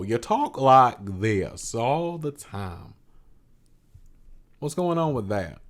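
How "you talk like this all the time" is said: very slow, stretched-out Southern imitation; mocking slow talk. Teasing a co-host about slow Southern talk, dragged out. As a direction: drag every word out in mockery. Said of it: "you talk slow".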